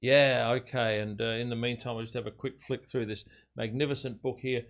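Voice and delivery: ordinary delivery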